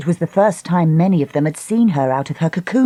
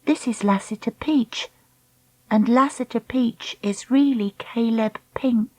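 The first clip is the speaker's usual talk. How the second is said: softly, barely voiced; hushed, gently breaking news to mum; quiet, tender reveal to her mother; 5 semitones higher, 4 dB quieter than usual. A narrator's reading. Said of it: "Dakota said softly".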